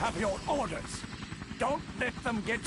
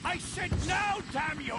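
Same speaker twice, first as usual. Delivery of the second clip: angry shout; 8 semitones higher than usual. Acted. Villain yelling at his troops.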